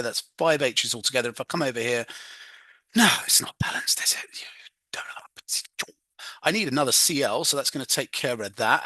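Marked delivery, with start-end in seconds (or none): 2.9-4.4 whispered aside to herself. Real, not acted. Teacher checks balancing, whispers aside. 4.4-5.9 whispered unsure aside; barely voiced, half to herself. Real, not acted. Unsure aside while balancing.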